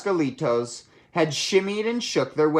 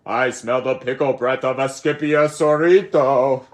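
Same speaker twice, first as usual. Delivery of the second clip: louder, in a big monster voice; dinosaur roar; 2 semitones higher, 7 dB louder than usual. Acted. T-Mexiquito smelling Scapito, booming monster roar. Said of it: "roared the T-Mexiquito".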